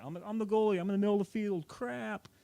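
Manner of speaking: high, hurried panicky inner voice; mock panic. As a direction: realize the danger mid-run. Panicked thought while running backwards.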